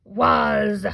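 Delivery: loud, drawn-out monster growl. Shown as a demonstration. Teacher reviewing sight words with kindergarteners, monster voice. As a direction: growl each word by itself, long and loud. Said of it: "Are you using your monster voice?".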